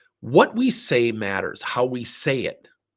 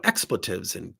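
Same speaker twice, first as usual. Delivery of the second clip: ordinary talk, nothing marked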